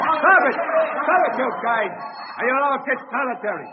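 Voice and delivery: loud, high shouted order; angry yell. Acted. Guard shouting loud and high at the quarrelling prisoners. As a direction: bark it down the cell block.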